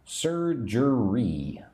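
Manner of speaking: Surgery drawn out very slowly; Surgery spoken extremely slowly. Shown as a demonstration. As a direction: spell out Surgery by sound for learners. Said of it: "Have you had any surgeries?".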